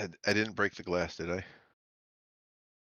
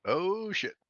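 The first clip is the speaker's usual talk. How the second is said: surprised; 10 semitones higher than usual. Real, not acted. Player reacts to hot coffee spill.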